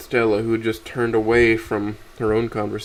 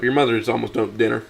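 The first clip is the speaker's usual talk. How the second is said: ordinary delivery, nothing marked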